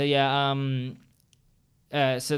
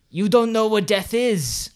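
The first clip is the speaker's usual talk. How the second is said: slow, louder, higher dramatic quote; quoting a movie line; 6 semitones higher, 6 dB louder, slower than usual. Retold or imitated. Quoting Halloween two's Sam Loomis to a friend, slow and weighty. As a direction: deliver with ominous weight. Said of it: "the one I switched".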